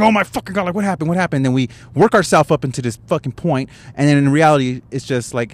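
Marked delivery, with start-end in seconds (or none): 0.0-1.4 mock panic, higher and rushed; panicked. Imagining the worst after a phone call, mock panicked. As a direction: act out an anxious spiral, pitched up. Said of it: "we start panicking, and then we start getting all anxious".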